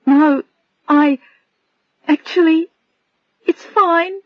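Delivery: through tears. Acted. Adrian trying to reassure Bill while crying, tearful. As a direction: force a brave smile through the tears. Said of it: "tried to smile through her tears".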